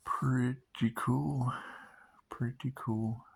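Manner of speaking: "Pretty cool" stretched out very slowly, falling at the end; slow drawn-out. Shown as a demonstration. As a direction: drag out "Pretty cool" lazily.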